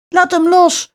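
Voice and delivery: high-pitched storybook cry; shout. A narrator's reading. Grietje demands the witch free her brother, high shout. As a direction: cry out high without full volume. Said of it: "schreeuwde Grietje".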